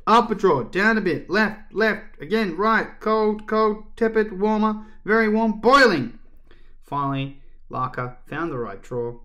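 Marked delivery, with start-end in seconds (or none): 0.1-6.2 high, loud guiding calls; insistent high-pitched calls, building to a peak; calling out directions. A narrator's reading. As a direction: shout hot-and-cold clues to searchers. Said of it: "calling out directions".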